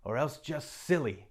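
put-on a motherly beaver teasing the girl; Missus Beaver.

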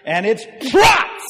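high, loud burst on "prots!"; exploding shout. Acted. Preacher describing sheep bursting free, shouted. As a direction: blurt it out like an explosion.

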